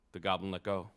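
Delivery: plain reading voice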